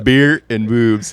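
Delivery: slow, deadpan mock of the guy; slow, earnest imitation of the video guy; mock serious quote. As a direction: drag it out like a solemn creed.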